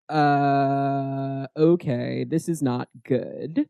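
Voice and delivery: low, soft, slow understatement; hesitate, then quietly admit trouble; Tessa. Acted. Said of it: "Tessa is like".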